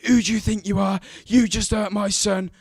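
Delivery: loud, high shout of an angry mother; hurling abuse. Retold or imitated. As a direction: yell accusingly at your son's attacker. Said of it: "still hurling abuse at me".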